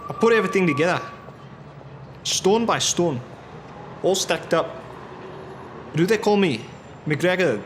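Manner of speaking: put-on Scottish accent. McGregor bragging to his buddies at the bar, in a Scottish accent. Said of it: "Oh, I forgot my accent".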